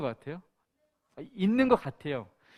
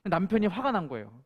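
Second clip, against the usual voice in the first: ordinary talk, nothing marked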